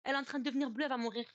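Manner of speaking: sobbing begging that keeps building in panic; retold crying plea. Cousin crying on the phone as his mother turns blue, rising tearful plea. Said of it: "Il commence à pleurer".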